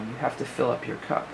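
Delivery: normal talking voice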